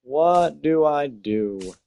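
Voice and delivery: a real groan of indecision; exasperated wail. Real, not acted. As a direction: throw your hands up at the screen. Stuck on a trade decision.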